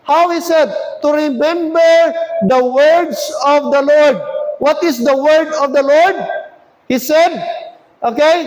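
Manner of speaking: high, punched-out scripture phrases; emphatic preaching. Real, not acted. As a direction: hammer the quoted words phrase by phrase.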